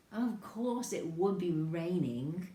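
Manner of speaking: slow, loud sulky complaint; grumbling. A narrator's reading. Boy complains about rain spoiling exploring, grumbling. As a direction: drag every word out in disgust. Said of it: "Stop grumbling".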